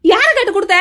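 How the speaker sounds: loud, high angry outburst; furious demand. Acted. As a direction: shout the accusing question. Furious at unauthorised return of document.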